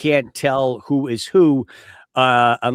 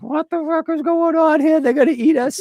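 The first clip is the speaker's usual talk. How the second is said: much higher, alarmed panicked outburst; Mike panicking. Retold or imitated. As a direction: freak out like you just realised they'll eat you. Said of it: "And Mike is like".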